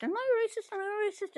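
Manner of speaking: high-pitched retold protest; mock disbelief in a high voice; mock indignant. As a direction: imitate someone protesting, pitch jumping way up.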